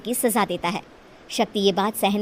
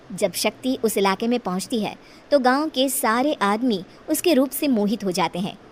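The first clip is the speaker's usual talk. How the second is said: usual speaking voice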